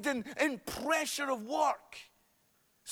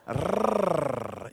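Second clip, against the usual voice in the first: long, low trilled R; rolled R; 8 semitones lower than usual. Shown as a demonstration. Preacher demonstrates rolling R's to the congregation, long low trill. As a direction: roll a drawn-out R on the tongue. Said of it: "roll your R's".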